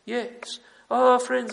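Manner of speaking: usual speaking voice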